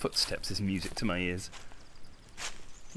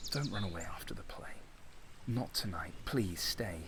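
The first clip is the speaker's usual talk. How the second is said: "Don't run away after the play" breathed with no voice; whispered plea; 4 semitones lower, 7 dB quieter than usual. Acted. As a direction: beg her in a hush. Lover pleading in a whisper.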